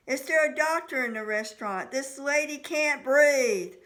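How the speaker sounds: loud, slowing call for help across a room; booming call. A narrator's reading. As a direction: boom it out to the whole restaurant. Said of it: "Mike's voice boomed across the room".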